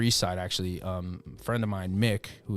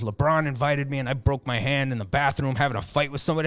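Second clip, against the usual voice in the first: ordinary delivery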